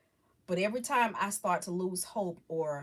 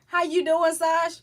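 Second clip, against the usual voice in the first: 1 loud greeting; 9 semitones higher, 9 dB louder than usual. Real, not acted. Greeting a chat viewer loudly.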